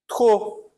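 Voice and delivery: "Trop" with silent final P, trô; teacher models "Trop" without the P; French demo. Shown as a demonstration. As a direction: clip "Trop" and drop the P. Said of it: "não pronuncio o P".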